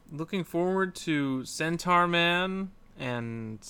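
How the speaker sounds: ordinary talk, nothing marked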